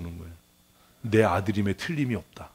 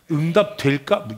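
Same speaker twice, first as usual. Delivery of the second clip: normal talking voice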